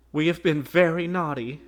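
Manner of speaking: put-on children calling out excitedly; children crying out. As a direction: burst out eagerly as small children. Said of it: "they cried".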